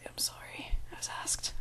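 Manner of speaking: very soft, mock-meek whisper; whispered apology. Vlogger pleading with imagined accent coach, whispered apology. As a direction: whisper a sheepish excuse.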